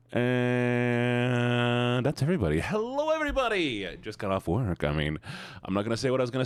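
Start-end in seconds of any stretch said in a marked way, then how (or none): 2.6-3.9 high sing-song greeting. Real, not acted. Streamer greets the whole chat at once, high sing-song.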